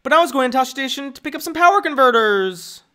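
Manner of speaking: Luke impression. Streamer doing Luke, high and whiny. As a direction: whine it like a frustrated farm boy. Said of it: "Luke's impression".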